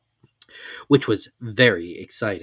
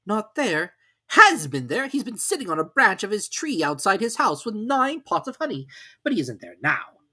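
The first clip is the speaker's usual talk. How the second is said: higher story voices; character voices; 10 semitones higher, 3 dB louder than usual. A narrator's reading. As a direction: swap into the characters.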